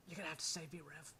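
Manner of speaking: ordinary talk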